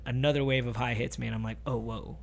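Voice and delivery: stoned, hushed disbelief; dazed hush. Retold or imitated. High wave hits, retold.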